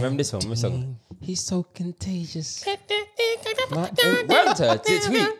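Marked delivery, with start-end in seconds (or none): 1.2-2.7 slow, much softer and higher teasing; mock high voice. Mocking co-host, high voice. 2.7-5.4 falsetto impression. Impersonating a singer, falsetto.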